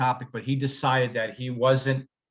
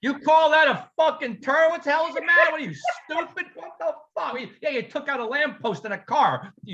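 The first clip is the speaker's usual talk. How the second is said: mock angry instructor; 11 semitones higher than usual. Instructor to student driver, hammed-up furious yelling. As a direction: bark at a hopeless student driver.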